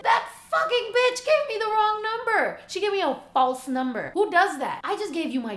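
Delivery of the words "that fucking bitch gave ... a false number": higher, louder outraged rant; indignant outburst. Real, not acted. Vlogger fuming about the fake number, high and loud. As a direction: vent like you've been betrayed.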